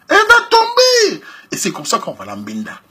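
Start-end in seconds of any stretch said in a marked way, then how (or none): 0.1-1.2 "Elle va tomber!" called out much higher and louder, slowly; triumphant shout. Shown as a demonstration. Telling the men she will give in, shouted in triumph. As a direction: trumpet "Elle va tomber!" as the big payoff. Loud punchline after quiet take.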